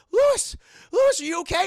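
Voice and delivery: high, loud worried shout; mock alarmed calling. Anna sees dead Lewis walking around, alarmed shout. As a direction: call out to him urgently, acted. Wife calls to risen husband.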